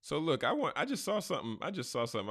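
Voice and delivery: high, loud and eager; excited. Real, not acted. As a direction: burst in with news. Excited about a find.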